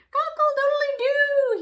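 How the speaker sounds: high, loud rooster crow. Acted. Farmer on the chicken coop perch, crowing high and loud. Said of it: "he crowed".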